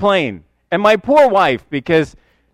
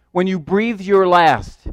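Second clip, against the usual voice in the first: ordinary talk, nothing marked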